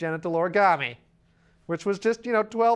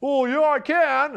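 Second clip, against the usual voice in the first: higher, louder, slow cocky retort; cocky drunk; 7 semitones higher, 6 dB louder, slower than usual. Retold or imitated. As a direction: insist like a proud drunk. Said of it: "And the first guy's like".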